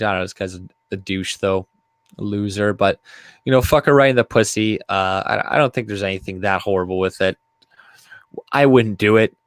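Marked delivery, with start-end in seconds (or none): none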